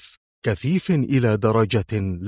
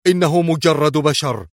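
higher-pitched sharp outburst; sharp exclamation; 5 semitones higher, 4 dB louder than usual. A narrator's reading. Officer protesting that the man is only human, sharp. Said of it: "هتف أندريان في حدة".

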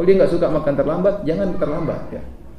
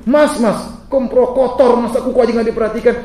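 nagging wife; 7 semitones higher than usual. Retold or imitated. Wife scolding husband.

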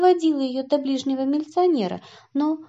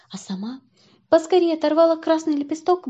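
ordinary delivery

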